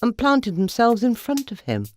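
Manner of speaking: usual speaking voice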